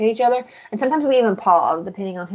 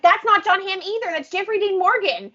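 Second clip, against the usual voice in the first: exasperated correction; 10 semitones higher than usual. Retold or imitated. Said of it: "I was like".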